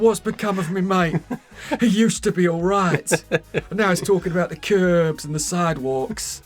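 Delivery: hammed-up worried British mate; worried friend. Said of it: "It's like, / his face, he's so worried".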